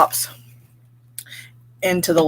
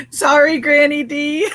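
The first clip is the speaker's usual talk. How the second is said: sorry through real laughter; laughing apology; 8 semitones higher, 3 dB louder than usual. Real, not acted. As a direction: apologise while still laughing, pitched up.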